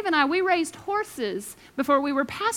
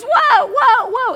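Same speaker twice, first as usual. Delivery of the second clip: much higher, loud shouted whoa; panicked yell; 10 semitones higher, 8 dB louder, much slower than usual. Retold or imitated. Rider shouting at the bolting horse, loud and panicked. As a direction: scream at a runaway horse.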